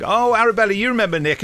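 bright introduction, lightly voiced; chirpy host introducing a guest; quoting David. David introducing Nick at the wedding party, lightly voiced.

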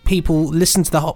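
ordinary talk

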